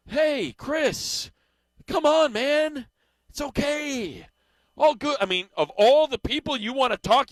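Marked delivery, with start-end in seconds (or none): 0.1-5.2 higher, slow calming voice talking a fan down; soothing Dave impression. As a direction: talk him off the ledge, gently and higher. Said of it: "Talk like you described perfectly, talking the guy off the ledge".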